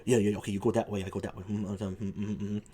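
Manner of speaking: low, hushed ape conspirators plotting; huddling apes. Acted. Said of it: "They, like, go like".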